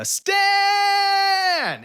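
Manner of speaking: high, loud, frantic shout of a name; desperate yell. Retold or imitated. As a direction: scream for his attention. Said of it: "yelling uncontrollably at the top of my lungs".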